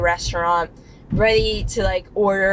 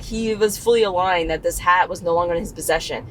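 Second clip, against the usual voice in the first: ordinary talk, nothing marked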